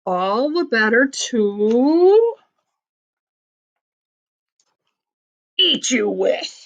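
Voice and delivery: slow, drawn-out storybook wolf; menacing wolf. A narrator's reading. The wolf answering Little Red, slow storybook menace. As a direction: stretch it out, holding suspense before the pounce.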